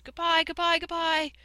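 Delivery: put-on very high, loud children's farewell yell; screamed goodbyes. A narrator's reading. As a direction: scream it out like excited children chasing a car. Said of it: "the children raced alongside and screamed".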